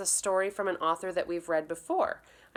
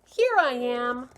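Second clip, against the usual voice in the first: louder, higher, slow shout; 7 semitones higher, 6 dB louder, slower than usual. Acted. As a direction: pop out triumphant. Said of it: "shouted Peter".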